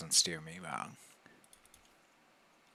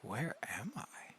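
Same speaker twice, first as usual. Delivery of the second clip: whisper; 3 semitones higher, 7 dB quieter, slower than usual. Real, not acted. Lost in new room.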